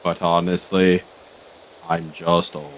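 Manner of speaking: put-on deep elderly confession; Guru voice. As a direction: Guru admitting he is just old. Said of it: "I am just Super Kami Guru".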